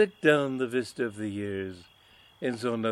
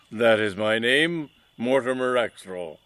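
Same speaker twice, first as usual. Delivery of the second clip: mustache twirling villain; 7 dB louder than usual. Acted. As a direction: announce your name like a stage villain. Villain introduces himself.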